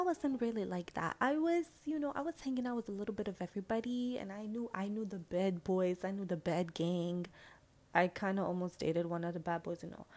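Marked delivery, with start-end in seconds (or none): none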